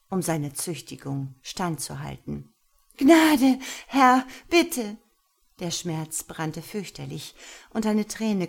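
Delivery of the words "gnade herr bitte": high, loud begging cry; desperate pleading. Acted. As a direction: beg him to stop in pain. Lena begs during caning. (Lena fleht den Herrn laut um Gnade an)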